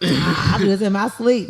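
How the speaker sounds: high, loud bragging; mock cocky boast. Friend voicing a player getting hyped about a rival, mock cocky boast.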